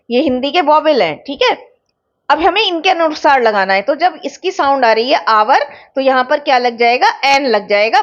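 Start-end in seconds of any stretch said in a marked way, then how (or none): none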